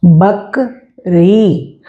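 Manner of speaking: "बकरी" dragged out very slowly, long ई at the end; slow spelled-out reading. Shown as a demonstration. Teacher reading a new word to pupils, very slowly. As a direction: stretch every syllable of "बकरी". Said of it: "और शब्द देख लेते हैं".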